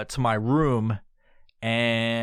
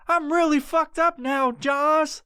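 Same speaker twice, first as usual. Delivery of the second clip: very high, squealing put-on voice; hammed-up high wail; high-pitched freak-out; 3 dB louder, slower than usual. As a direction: squeal it in comic panic.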